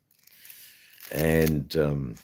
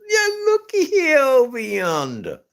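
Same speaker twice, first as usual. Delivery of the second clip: fond Southern American imitation; Southern accent; 4 dB louder than usual. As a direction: imitate the Southern friend, touched. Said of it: "and he's just".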